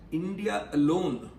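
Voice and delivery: "alone" set apart with slow, deliberate emphasis; stress on "alone". Real, not acted. Lecturer asking audiences why India stands out, stressing "alone". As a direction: lean on the word "alone", drawing it out. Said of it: "I emphasize the word alone".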